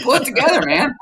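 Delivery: high, mock coach's pep-talk bark; mock coach scolding. Guest imitating the manager scolding a crying player, mock high and stern. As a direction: lightly play the manager telling him to toughen up. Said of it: "That was you".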